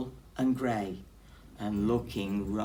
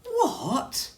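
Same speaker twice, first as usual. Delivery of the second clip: very high squeaky what; high startled squeak; shocked mouse; 6 dB louder than usual. Acted. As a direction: gasp it out in shock.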